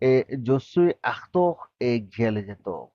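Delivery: slow, careful French for learners; slow demo. Shown as a demonstration. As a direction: spell the phrase out slowly for the class. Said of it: "teacher models French line".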